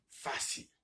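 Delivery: a breathy whisper; whispered aside. Shown as a demonstration. Teacher whispering a quick aside to the class. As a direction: drop to a whisper, like a secret. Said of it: "teacher whispers an aside".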